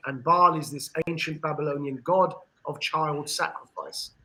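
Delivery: ordinary talk, nothing marked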